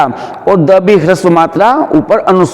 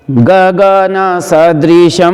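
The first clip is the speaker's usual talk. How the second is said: गगन सदृशम slowly chanted on a level tone; unaccented स in a measured chant of गगन सदृशम; no stress on स; much slower than usual. Shown as a demonstration. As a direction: keep every syllable of गगन सदृशम even. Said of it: "यहाँ पर बलाघात नहीं होगा".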